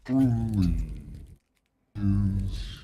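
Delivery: a lightly voiced old dragon, considering. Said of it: "dragon deep voice".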